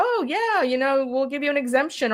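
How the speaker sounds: high, swooping imitation of easy assent; mock eager agreement. Teller imagines bosses granting an exemption, mock agreeable. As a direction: agree airily, as the imagined boss.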